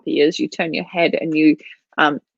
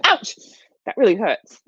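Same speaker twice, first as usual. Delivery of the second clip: "Ouch" yelped very high, then "that really hurts" plain; 4 semitones higher than usual. Shown as a demonstration. Trainer demonstrating the reaction to a bite, high yelp. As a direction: yelp the ouch like a hurt pup. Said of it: "if you're telling him".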